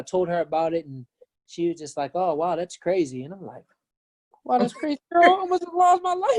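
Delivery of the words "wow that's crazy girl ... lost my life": much higher, louder exasperated echo; incredulous mockery. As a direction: throw her words back in disbelief. Mocking ex's flat reaction.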